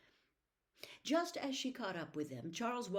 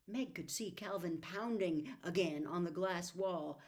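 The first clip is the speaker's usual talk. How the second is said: plain reading voice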